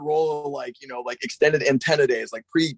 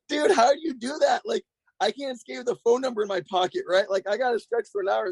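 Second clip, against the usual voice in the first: high-pitched incredulous reenactment; retold amazement; 7 semitones higher than usual. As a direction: replay your disbelief at a friend's trick, voice shooting up. Acts out his amazed reaction.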